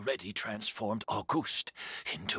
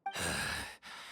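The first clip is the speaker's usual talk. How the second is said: weary sigh; 9 semitones lower, 5 dB quieter than usual. Acted. Tired character admits weakness.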